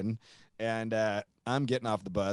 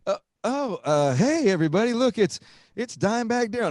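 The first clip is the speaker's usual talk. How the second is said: higher, louder hype-man call to the crowd; stage announcer; 10 semitones higher, 7 dB louder than usual. Retold or imitated. [name] announcing [name] to the crowd, hyped announcer voice. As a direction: announce him like a surprised frontman. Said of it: "[name] looks over at him and [name] goes".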